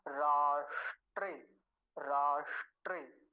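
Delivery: राष्ट्रे with a clear retroflex ष, slow and loud; careful pronunciation demo. Shown as a demonstration. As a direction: say राष्ट्रे slowly, half ष distinct. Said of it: "अच्छे से ध्यान से उच्चारण करेंगे".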